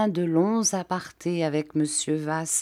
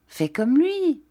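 coaxing; 9 semitones higher, 4 dB louder than usual. A narrator's reading. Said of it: "dit-elle".

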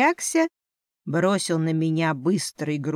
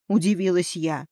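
ordinary delivery

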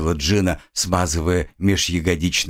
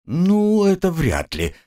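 purring; 9 semitones higher, slower than usual. A narrator's reading. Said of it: "мурлыкнула рыжая".

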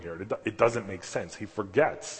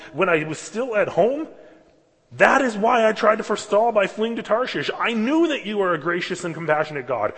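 higher, louder protest to God; angry prayer; 7 semitones higher, 7 dB louder than usual. Acted. Jonah complaining bitterly to the Lord, angry. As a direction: hurl it at God in frustration.

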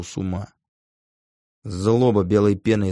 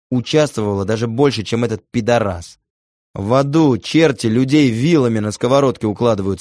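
loud, raised hateful rant; furious tirade; 3 semitones higher, 5 dB louder than usual. A narrator's reading. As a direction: curse her with rising fury. Furious curse at ex-lover.

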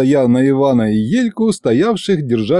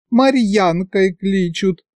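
put-on slow, high coy girlish voice; bashful bride; 8 semitones higher, slower than usual. A narrator's reading. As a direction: answer shyly, drawing it out. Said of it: "потупила она глазки".

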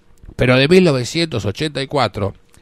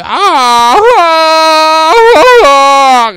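loud, high jungle yell like Tarzan; 11 semitones higher, 12 dB louder, much slower than usual. Acted. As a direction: belt out a hammed-up Tarzan cry. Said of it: "empezó a gritar como Tarzán". (grito de Tarzán exagerado)

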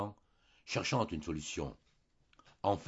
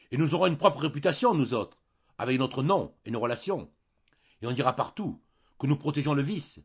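furious tirade, much higher and louder; burst out; 7 semitones higher, 9 dB louder than usual. A narrator's reading. Husband exploding about the family's reputation, furious. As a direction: explode at her. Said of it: "Alors, Julien éclata".

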